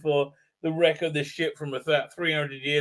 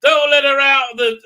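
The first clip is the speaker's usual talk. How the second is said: loud, high East London shout; hammed-up East London cry of warning; mock East London accent; 8 semitones higher, 13 dB louder than usual. Son warning Dan about the statue, mock East London accent. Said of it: "in his East London accent".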